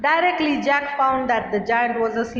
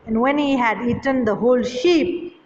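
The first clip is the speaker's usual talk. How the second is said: ordinary delivery, nothing marked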